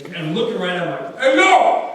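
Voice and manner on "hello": very high, shouting mock dictator on a prank call; Muammar Gaddafi. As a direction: bellow threats like a cartoon dictator. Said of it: "So I call this guy up as Muammar Gaddafi".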